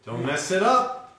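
teasing warning. Pretend. Cook teasing Stu before he adds the cheese, mock-stern and slow. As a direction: drag it out like a playful threat.

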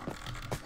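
long unvoiced sigh; weary sigh. Real, not acted. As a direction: sigh out the frustration. Sigh after bad luck.

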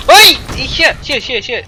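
high, loud frantic swearing; panicked cursing. Real, not acted. A gamer panics at the horde, swearing loudly. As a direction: swear in a panic as zombies swarm.

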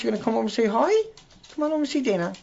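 high pet-directed voice; coaxing a pet. Real, not acted. Host breaks off to invite Zoe over, high coaxing. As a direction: lift the voice high to coax. Turns aside to coax pet.

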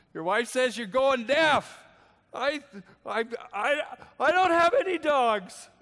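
put-on high, loud comic old-man voice mishearing; deaf old man. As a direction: ham up the confused deaf man.

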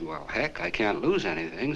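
normal talking voice

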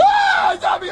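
very high panicked shriek; terrified scream. Acted. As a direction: shriek at a sudden zombie. Sees friend turned zombie.

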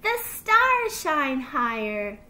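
slow, loud, gleeful announcement; little fox crowing the answer; laughing delight, no laugh. A narrator's reading. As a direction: draw it out with childlike triumph. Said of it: "he laughed".

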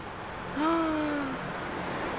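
sharp gasp of delight; a quick surprised intake of breath. Real, not acted. Spots a squirrel, gasps.

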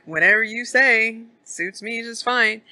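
a hint of a wary old prospector; old man lightly voiced, brushing it off. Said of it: "and he's like".

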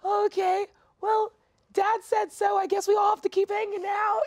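high, loud, overwrought voice; hammed-up group reaction. Castmate acts out the group giving in to Dad, high hammed-up cry. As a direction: push it high and loud, mock-emotional.

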